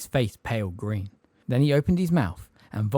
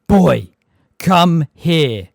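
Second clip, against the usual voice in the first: much louder furious bellow; angry shout; 6 semitones higher, 14 dB louder, much slower than usual. A narrator's reading. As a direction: explode at the boy. Vernon's explosion.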